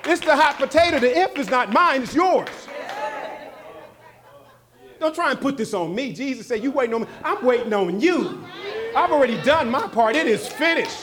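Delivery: loud, high-pitched sermon delivery; shouted pulpit rebuttal to the congregation; full-force preaching. Acted. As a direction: preach it hard, driving each phrase home.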